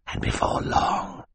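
slow, whispered threat; menacing mutter. A narrator's reading. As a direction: mutter it under the breath, slowly. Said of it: "Stefano muttered to the children".

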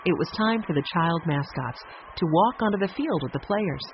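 usual speaking voice

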